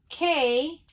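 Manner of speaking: usual speaking voice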